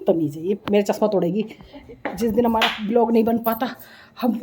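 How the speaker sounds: low, soft whispered aside; hushed complaint. Real, not acted. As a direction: whisper it so she won't hear.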